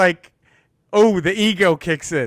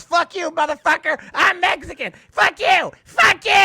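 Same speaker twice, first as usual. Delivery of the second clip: high, building mock yell at the comedian; shrill-free shrieking heckle, rising to a shout; angry heckler; 12 semitones higher than usual. Heckling woman yells at comedian on stage, angry mock shout. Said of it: "Mexican woman in the back of the bar goes".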